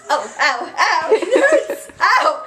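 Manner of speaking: high laughing yelps of pain; real ouch, half laughing; yelping in pain. Real, not acted. Hurt by the cat.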